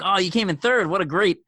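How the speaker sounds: re-enacted excited interviewer; super excited. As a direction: gush with excited praise. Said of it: "I was, like, super excited".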